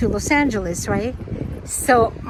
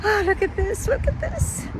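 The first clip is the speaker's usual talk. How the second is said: awed high squeal; 8 semitones higher, 4 dB quieter than usual. Real, not acted. Sees a beautiful lake.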